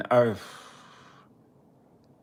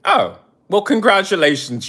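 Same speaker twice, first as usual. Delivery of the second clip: mock congratulations; 8 semitones higher, 6 dB louder than usual. Real, not acted. Mock congratulating young fan.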